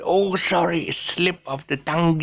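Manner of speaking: high-pitched apology, "tongue" as "tung-you"; mispronounced tongue. Retold or imitated. Guy apologising to his friend, high, "tongue" as tung-you. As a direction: say sorry in a high voice, "tongue" becoming two-beat "tung-you". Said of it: "No, it is not tongue".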